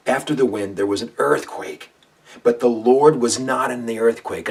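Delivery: loud, booming preacher reading of the passage; dramatic scripture reading. Acted. Preacher reading First Kings to listeners, booming. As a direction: proclaim it like thunder over the mountain.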